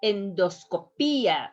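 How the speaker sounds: "endoscopía" with a strong, long PÍ, falling on the final a; "endoscopía" as en-dos-co-PÍ-a, emphatic Í; stress on accented vowel. Shown as a demonstration. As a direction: lean on the Í in "endoscopía". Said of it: "you pronounce a little bit strong".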